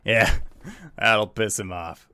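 lightly voiced gruff bar patron; a hint of a gruff tavern regular; gruffer voice. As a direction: finish the boast with a slight gruffness. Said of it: "you hear a gruffer voice say".

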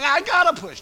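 high, loud mock yell; desperate labor cry. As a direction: imitate a wife yelling in labor. Wife's desperate retold cry.

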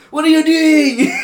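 high, loud, slow cry; mock Shrek shouting to Puss; alarmed Shrek call. Quoting Shrek again.

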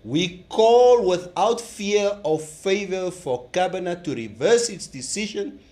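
reading an official press statement; solemn, deliberate statement delivery; formal statement reading. Acted.

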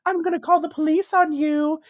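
high, frantic pleading; shrill terrified begging at the door; panicked scream. Retold or imitated. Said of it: "and she's like".

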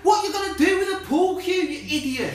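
high, loud, laughing through it; exasperated rant. Real, not acted. Ranting at the movie porter, exasperated yell. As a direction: yell at the screen in disbelief.

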